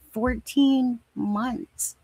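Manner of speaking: "Fourteen months", very slow and high-pitched for emphasis; drawn-out amazement. Real, not acted. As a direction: dwell on each word of "Fourteen months" in disbelief. Stressing how long it's been.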